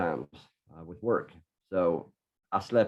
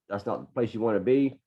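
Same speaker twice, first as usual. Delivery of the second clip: ordinary delivery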